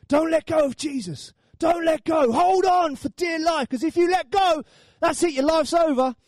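hammed-up desperate urging; urgent exhortation. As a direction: preach it like lives depend on it. Urgent exhortation mimicking church teaching.

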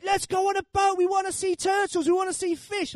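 very high imitated children's voice; squealed, insistent child impression; excited kids. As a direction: mimic kids clamouring for a treat. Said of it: "And the kids are like".

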